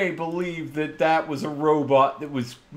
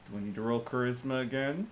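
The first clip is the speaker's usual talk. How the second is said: ordinary talk, nothing marked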